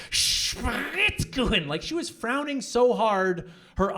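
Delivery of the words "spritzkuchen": slow, louder, drawn-out "Spritzkuchen"; angry baker. Retold or imitated. Angry German baker to customer, slow drawn-out mock anger. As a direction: hurl the word back at him. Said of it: "She's like".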